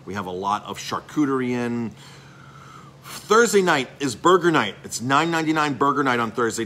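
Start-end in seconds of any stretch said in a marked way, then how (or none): 3.0-6.6 pumped-up shop owner hyping burger night; excited announcement. Real, not acted.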